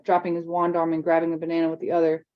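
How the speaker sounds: usual speaking voice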